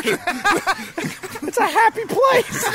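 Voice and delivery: high, slow sugary cheer; mock cheerful. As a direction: sell it like a theme park host. Mocking Disney cheer.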